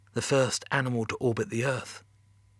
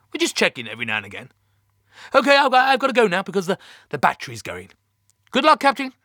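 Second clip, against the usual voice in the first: raised, higher-pitched brush-off; mission control boss; 7 semitones higher, 6 dB louder than usual. Acted. Mission Control ending the call to the captain, raised and hurried. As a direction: brush him off and hurry off the call.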